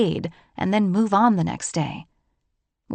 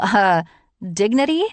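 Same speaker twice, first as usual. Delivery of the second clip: louder sarcastic rise on dignity; 5 dB louder than usual. A narrator's reading. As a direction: toss out dignity like it's obvious.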